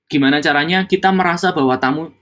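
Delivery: ordinary delivery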